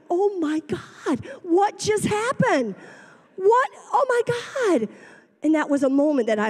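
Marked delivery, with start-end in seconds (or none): 0.1-4.9 high, tearful gasping wonder, rising to a squeal; overjoyed disbelief. Retold or imitated. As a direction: relive the thrill of a lucky find, near tears. Joy finding the five-dollar tricycle.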